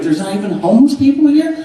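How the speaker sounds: high, quick incredulous question; amazed tourist. Acted. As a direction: ask in rising disbelief.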